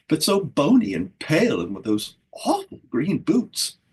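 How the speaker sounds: barking fairy. A narrator's reading. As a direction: sneer at the boots. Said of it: "barked another".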